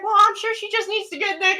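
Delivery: high imitation of his insisting; pushy drunk. Drunk passenger talking about the driver, high mock insistence. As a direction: pitch it way up as the persistent drunk.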